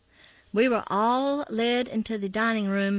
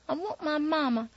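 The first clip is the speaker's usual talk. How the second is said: tearful; 6 semitones higher than usual. A narrator's reading. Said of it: "I started to cry again".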